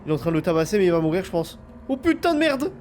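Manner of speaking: very high, loud panicked yelling; frantic shouting. Real, not acted. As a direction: yell in full panic. Frantic panic during attack.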